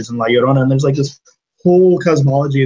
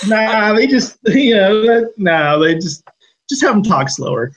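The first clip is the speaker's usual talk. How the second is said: higher, lightly mocking studio voice with a laugh; dismissive Hollywood filmmakers; 8 semitones higher than usual. Old Hollywood brushing off regional accents, lightly mocked dismissal. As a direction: wave the problem away, pitched up. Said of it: "but they're like".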